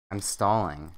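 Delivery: plain reading voice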